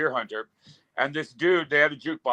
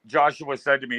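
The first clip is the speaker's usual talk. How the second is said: ordinary delivery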